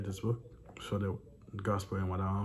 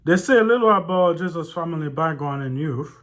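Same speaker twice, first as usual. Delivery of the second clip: loud, higher read-aloud voice; 8 semitones higher, 13 dB louder than usual. A narrator's reading. Reading a disputed book to viewers, loud read-aloud voice. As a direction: project the text out loud.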